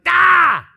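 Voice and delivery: sudden loud frustrated shout of "Ah!"; exasperated yell. Retold or imitated. Preacher acting out snapping at people who keep needling, a loud cry. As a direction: blow up after being pushed too far. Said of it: "finally you're like".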